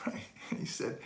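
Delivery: usual speaking voice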